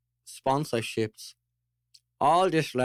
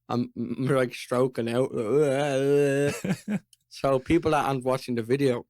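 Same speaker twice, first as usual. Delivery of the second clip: ordinary talk, nothing marked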